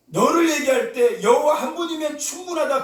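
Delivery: high, loud preaching declaration; full-force preaching. Real, not acted. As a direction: proclaim it to the whole hall.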